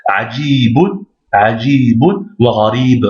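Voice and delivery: "Ajibun" with a long held "jii"; prolonged i. Shown as a demonstration. Teacher reading the exercise, long i in "Ajibun". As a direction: stretch the i in "Ajibun" and "gharib". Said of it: "c'est la prolongation du i".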